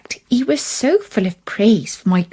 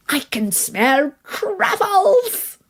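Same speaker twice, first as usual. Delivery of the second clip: much higher, slow, with a delighted laugh; gleeful exclaim; 10 semitones higher, much slower than usual. Acted. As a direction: savour the discovery with a laugh. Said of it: "he exclaimed".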